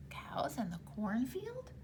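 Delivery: startled unvoiced whisper. Tom doubting the leprechaun, startled whisper. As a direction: breathe it in disbelief.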